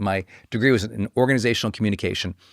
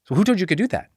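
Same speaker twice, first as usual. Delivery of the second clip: higher-pitched, mildly affronted repeat; upset challenge; 6 semitones higher, faster than usual. Retold or imitated. Teacher repeating the challenge to the graduate, mildly upset. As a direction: press the question like an offended objector. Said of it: "this person was really upset about it".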